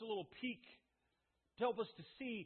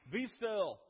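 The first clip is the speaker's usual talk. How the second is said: louder, slow, firm command; commanding the storm; 3 semitones higher, 8 dB louder, slower than usual. Acted. Jesus at the bow ordering wind and waves, slow and commanding. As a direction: speak it with calm authority over the sea. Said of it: "he says".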